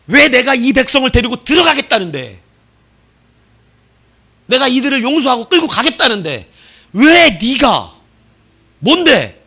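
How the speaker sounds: God shouting at Moses, indignant; the slowed 왜 네가 spat out in outrage; angry God rebuking. Acted. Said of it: "화가 나신 거예요".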